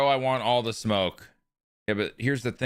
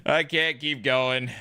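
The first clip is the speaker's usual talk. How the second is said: higher, slow, put-on moan; mock weary voice; 4 semitones higher, slower than usual. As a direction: drag it out like you're done for. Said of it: "laughs then repeats it".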